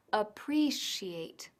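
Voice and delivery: appreciate whispered very slowly, stress on the second syllable pre; careful pronunciation. Shown as a demonstration. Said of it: "con el énfasis en la segunda sílaba".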